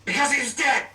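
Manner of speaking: high, loud punchline; punchline shout. Real, not acted. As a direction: blurt the punchline out gleefully. Punchline of a joke.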